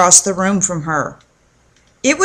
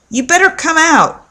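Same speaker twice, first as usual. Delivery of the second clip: a higher call through the door; 7 semitones higher than usual. A narrator's reading. Russell calling to his sister through the door, higher. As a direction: call her out of the bathroom. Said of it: "he called to Elisa".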